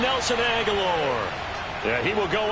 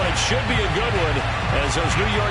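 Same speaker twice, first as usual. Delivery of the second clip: ordinary talk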